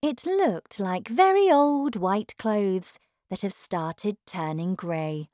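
plain reading voice

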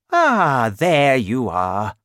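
loud, drawn-out greeting; welcoming bishop. Acted. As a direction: hail him like an expected guest. Bishop greets Valjean warmly.

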